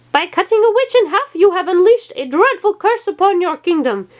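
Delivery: very high ominous witch voice; witch's curse. A narrator's reading. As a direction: lay a dreadful curse. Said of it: "it told the petrified king".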